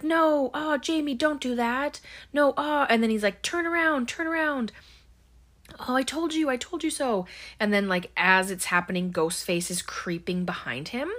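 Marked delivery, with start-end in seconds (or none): 0.0-2.9 high-pitched yell at a film character; imitated frantic movie-watcher's yell; mock yelling. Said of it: "he's yelling at Jamie Lee Curtis". 3.4-7.3 high, urgent mock yell at the TV; lightly yelled, pitched well up. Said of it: "he's yelling at Jamie Lee Curtis".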